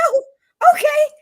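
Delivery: very high, loud, squealing okay; hammed-up high delighted acceptance; mock cheerful acceptance. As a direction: squeal it like everything is fine. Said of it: "I was like".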